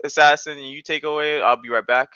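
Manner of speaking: normal talking voice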